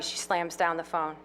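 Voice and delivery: ordinary delivery, nothing marked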